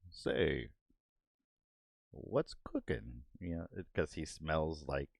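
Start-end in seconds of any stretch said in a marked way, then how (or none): 0.1-3.2 slow cartoon line. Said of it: "that's when it's like".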